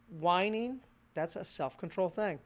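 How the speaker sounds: usual speaking voice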